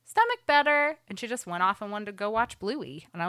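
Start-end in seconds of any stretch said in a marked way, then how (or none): 0.0-1.0 put-on higher, louder little-girl voice; toddler voice. Mom imitates daughter announcing she feels fine, lightly voiced toddler. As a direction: chirp it like a relieved toddler. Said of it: "she was like".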